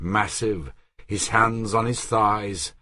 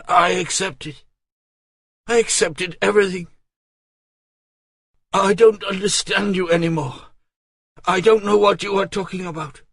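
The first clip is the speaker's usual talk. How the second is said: put-on higher, faster, pleading young woman; Marguerite distraught; 11 semitones higher, 4 dB louder, faster than usual. Marguerite protests to Artois, higher bewildered pleading. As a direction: rush it out in bewildered despair.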